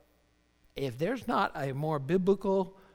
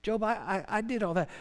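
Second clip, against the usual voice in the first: high-pitched mock-divine correction of Job; lightly voiced rebuke, pitched up; God; 7 semitones higher than usual. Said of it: "He's like".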